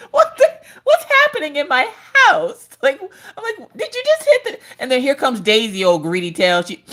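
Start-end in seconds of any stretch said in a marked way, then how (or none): 0.1-4.6 high squeals of laughter through the words; laughing disbelief. Real, not acted. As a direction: crack up while asking what's happening. Toast hits son, mom reacts.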